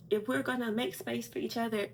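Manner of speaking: ordinary talk, nothing marked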